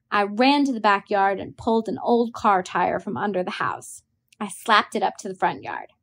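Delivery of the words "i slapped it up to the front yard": ordinary delivery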